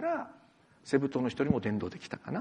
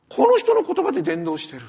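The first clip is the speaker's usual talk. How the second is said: amazed; 9 semitones higher, 10 dB louder than usual. Retold or imitated. Amazed onlookers at Pentecost.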